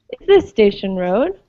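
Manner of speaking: "Is this Station Road?" with the voice climbing at the end; teacher models rising intonation for students; rising question tune. Shown as a demonstration. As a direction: lift each yes-no question upward at the close. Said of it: "repeat after me".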